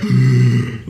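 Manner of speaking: exaggerated gasp into the mic; loud gasp. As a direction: imitate a noisy breath on a stream. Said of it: "you just hear them go".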